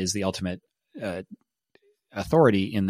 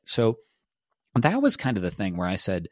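ordinary talk, nothing marked

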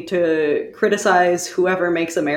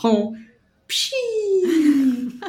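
laughing pshi; 9 semitones higher than usual. Shown as a demonstration. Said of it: "I will accept".

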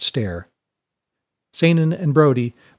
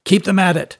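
higher-pitched, raised order; brisk command; 6 semitones higher, 4 dB louder than usual. Acted. Captain answering the mate's news, raised commanding voice. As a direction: urge the work on firmly.

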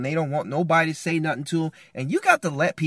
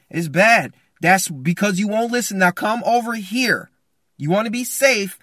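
loud, higher, unyielding call; firm calling out; 4 semitones higher, 6 dB louder than usual. Acted. Father stays in the cave calling family, loud and firm. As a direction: call out and stand your ground. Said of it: "to keep calling to them".

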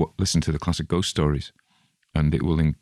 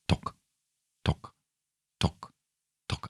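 soft, short tocks with even gaps; imitated tennis ball tock; 8 dB quieter, slower than usual. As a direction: click out a quiet, steady tock. Imitating tennis balls on television.

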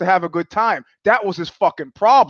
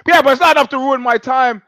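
imitating a loud, high-pitched retort; retold protest, voice raised; mock defiant; 7 semitones higher, 5 dB louder than usual. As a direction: fire back high and loud. Surfer's retort in retold quarrel.

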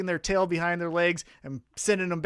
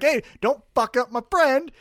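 mock shout; 8 semitones higher, 6 dB louder than usual. Eddie Kingston storms out.

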